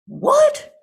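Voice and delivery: very high, loud startled "What?"; sudden squeal of shock. Acted. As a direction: shriek it in disbelief. Shocked outburst at the tale.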